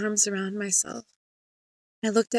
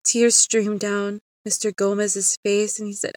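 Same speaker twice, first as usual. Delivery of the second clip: ordinary delivery, nothing marked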